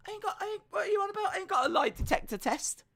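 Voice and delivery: very high, squeaky helper voice; Brian. Pretend. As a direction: squeak back as a flustered imaginary assistant. Voices imaginary helper Brian replying.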